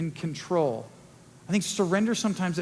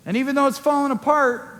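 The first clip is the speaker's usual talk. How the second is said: normal talking voice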